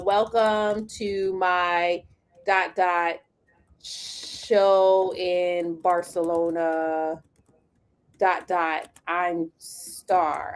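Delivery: slow, drawn-out reading of stilted AI copy with spoken dashes; slow mock chatbot read. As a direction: read it out slowly like clunky chatbot text, saying the dashes aloud. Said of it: "it'll be like".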